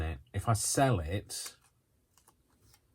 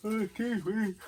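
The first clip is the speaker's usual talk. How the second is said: yawning. Real, not acted. Yawning again mid-talk.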